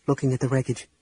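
ordinary delivery

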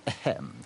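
low, soft "ahem"; officious throat-clearing. Acted. Guard about to recite a decree, officious throat-clearing.

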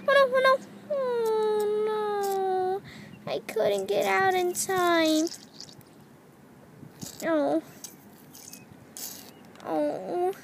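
high mock sobbing oh nos; hammed-up little cry; pretend crying. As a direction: wail like a doll who had an accident. Doll cries after accident.

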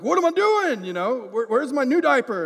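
high, loud frantic scramble for a diaper; panicking over the missing diaper; flustered panic. Retold or imitated. Dad alone with the baby searching for a diaper, loud frantic panic. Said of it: "Now I'm all flustered".

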